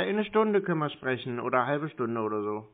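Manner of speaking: ordinary talk, nothing marked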